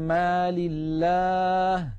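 drawn-out chanted مال الله with the nasal hum held; slow Quranic recitation. Shown as a demonstration. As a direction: recite it slowly, tajweed style. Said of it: "من".

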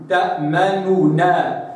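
slow تأمنونا with the damma snatched short; stolen damma. Shown as a demonstration. Teacher showing the stolen damma. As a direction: say تأمنونا, damma barely touched. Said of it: "اختلاس الضم".

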